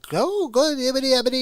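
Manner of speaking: high-pitched cartoonish babble; silly gibberish voice. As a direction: leap way up into squeaky nonsense. Gibberish clip noise inside the impression.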